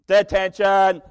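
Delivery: loud high preacher shout of detention; stern teacher shouting detention; loud teacher call. Acted. Teacher catching kids at the line.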